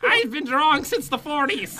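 high put-on old lady; hammed-up, high grandma impression; mocking grandma voice. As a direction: boast like an ancient granny. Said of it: "That's what she".

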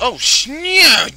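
sudden high yelp of fright; real startled cry. Real, not acted. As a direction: jump at the scare and yelp. Jump scare in horror game.

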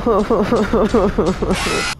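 high relieved laugh after a fright; nervous laugh. Real, not acted. Player laughing off a jump scare, nervous laugh.

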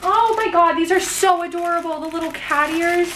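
high, bubbly squealing delight. Real, not acted. Squealing over cat ears.

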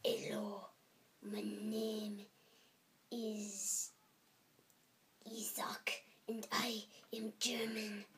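whispered bad German accent. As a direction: hush a mock German. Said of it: "try German".

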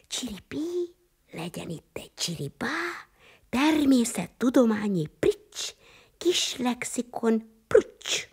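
sing-song the magic words; magic spell. Acted. Maminti conjures a lexicon for the children, chanting a spell.

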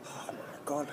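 breathy whispered oh my God; whispered gasp. Real, not acted. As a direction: whisper it in shock. Gasped reaction.

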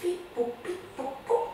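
high playful beep boop; be the spaceship controls; playful computer beeps for the children; robot beeps. Acted. Imitating control panel.

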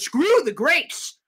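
a touch of taunting bravado; defiant retort. Retold or imitated. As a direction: throw the praise back with swagger. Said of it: "And Sal said".

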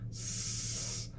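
S held and hissed; held s sound. Shown as a demonstration. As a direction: hiss a long S. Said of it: "getting that s up here".

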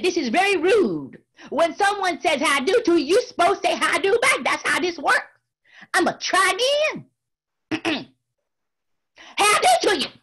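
high, loud, indignant scolding; mite upset. Acted. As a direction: scold the rude tar baby. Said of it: "Brer Rabbit got a mite upset".

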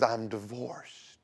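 slow, whispered confession; ashamed whisper. Retold or imitated. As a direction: breathe it out slowly in shame. Catherine confesses divorce.

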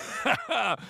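high-pitched laugh. Acted. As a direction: laugh off a warning. Laughs off the warning.

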